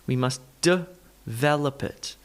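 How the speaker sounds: slow "develop", split duh-VEL-up; schwa demonstration. Shown as a demonstration. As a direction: isolate the uh in develop before VEL. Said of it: "add in the all-important schwa".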